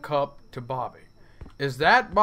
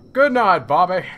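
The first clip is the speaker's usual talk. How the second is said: loud good night; 9 dB louder than usual. Acted. Dad calling good night to Bobby, loud and cheery. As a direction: boom it out to the kid. Loud mock goodnight to the boy.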